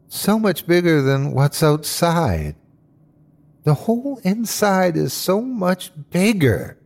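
frightened whine with drawn-out words; whiny, small, frightened. Acted. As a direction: sound small and overwhelmed by fear. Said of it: "It was whiny and small and frightened".

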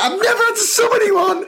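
high, laughing exclamation; laughing disbelief. Retold or imitated. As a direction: marvel at it through laughter. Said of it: "And then I was like".

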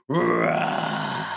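very low, loud roar; bear roar. Acted. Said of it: "The bear roared".

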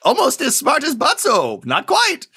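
high, slow put-on bookie voice; a squeaky-high NPC clerk, slowing down; booking agent voice. Booking agent keeps talking.

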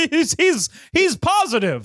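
high-pitched imitation of a moved fan; mock delight. Depressed American watching the show, mock delighted. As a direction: gush it high, hammed-up. Mocks depressed viewers clinging to positivity.

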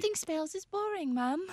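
put-on high-pitched reluctant kid witch; acted whiny child. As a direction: whine like a bored child to a parent. Voices a child arguing with parent.

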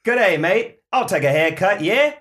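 put-on loud, high Aussie accent with a New York twist; high, loud, slow comic accent mashup; Australian-Boston accent mix. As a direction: mash up Aussie and Boston tough guy. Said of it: "two accents in one".

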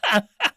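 high, sudden real laugh; burst of laughter. Real, not acted. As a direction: crack up at the joke. Reacting to own joke.

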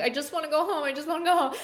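high, teary pleading; imitating crying. Actress quoting her tearful audition line, high and teary. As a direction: whimper it like a homesick kid. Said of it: "I just started crying and being like".

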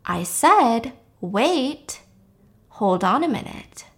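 measured and quiet, each phrase set apart; sober, normal voice. Retold or imitated. Drunk-seeming woman suddenly to the man on top of her, sober voice. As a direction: drop into a sober, deliberate tone. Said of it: "in a very sober, normal voice".

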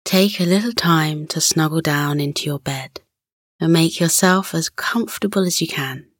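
guided relaxation. Acted. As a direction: gently settle a child to sleep.

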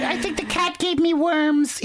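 high slow whiny wife voice. Retold or imitated. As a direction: squeak it out, slow and worried. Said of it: "She's like".

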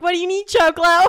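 loud, high teasing shout; cooks hollering. Retold or imitated. Cooks greeting her by nickname, mock holler. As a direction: holler it across a kitchen in imitation. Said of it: "anytime I came in, they're like".

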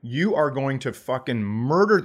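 usual speaking voice

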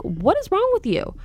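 high-pitched exasperated outburst; mad. Real, not acted. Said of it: "It makes me mad".

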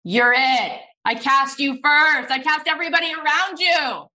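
high, loud acted proclamation, building; hammed-up big reveal, pitch climbing to the end; emphatic declaration. Director's climactic casting reveal, acted out.

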